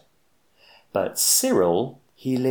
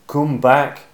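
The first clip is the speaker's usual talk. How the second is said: louder call after the flying cone; shout; 5 semitones higher, 8 dB louder than usual. Acted. Cyril calling after the cone carried off by a bird, loud shout. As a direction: yell at it to come back. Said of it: "shouted Cyril".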